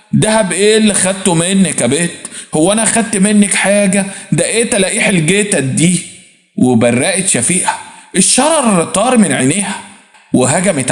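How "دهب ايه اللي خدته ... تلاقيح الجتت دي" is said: ordinary delivery, nothing marked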